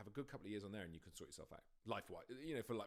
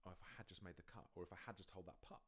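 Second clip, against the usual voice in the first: quiet regret; 4 semitones lower, 10 dB quieter than usual. Retold or imitated. Imagined regretful golfer thought.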